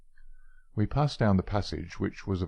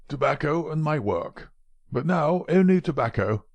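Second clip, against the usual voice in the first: put-on old man exclaiming; 7 semitones higher than usual. Old professor mourning his assistant, exclaiming in a higher voice. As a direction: cry out "Tobacco and my work" as a bitter echo. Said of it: "the old man exclaimed".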